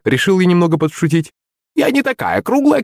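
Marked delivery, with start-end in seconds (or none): 1.8-2.8 high huffy crone voice; scoffing. Acted. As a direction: scoff like a vain old hag. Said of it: "хмыкнула старуха".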